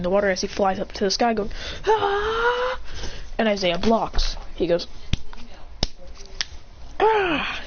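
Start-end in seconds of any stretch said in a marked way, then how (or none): 1.9-2.8 high-pitched "ah" cry. Retold or imitated. Story figure flies up crying out. 7.0-7.6 short high "ah" cry; acted cry. Story figure cries out.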